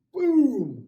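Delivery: loud, high "boom"; imitated blow-up. Storyteller shows the officer blowing up, explosive boom. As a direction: mimic an explosion for someone losing his temper. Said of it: "He blows up".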